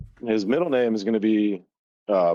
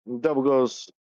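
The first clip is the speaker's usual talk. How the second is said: normal talking voice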